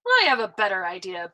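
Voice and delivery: boastful. A narrator's reading. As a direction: show off the idea lightly. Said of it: "boasted Arfie".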